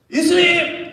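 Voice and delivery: loud, high cry of 예수님; calling out. Acted. Peter crying out to Jesus from behind, loud. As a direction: call his name across the crowd.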